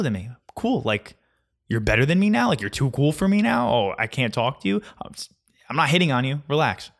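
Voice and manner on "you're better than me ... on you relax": normal talking voice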